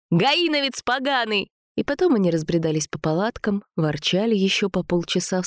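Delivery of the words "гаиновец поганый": high, heated name-calling; angry insult. A narrator's reading. Sasha insults Jacques, high angry retort. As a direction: fling the insult high and sharp.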